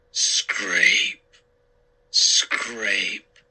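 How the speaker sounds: Scrape, scrape dragged low and slow; slow, heavy. A narrator's reading. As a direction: draw out Scrape, scrape ominously. Mummy footsteps described.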